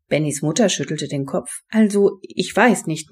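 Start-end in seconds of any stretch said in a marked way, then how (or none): none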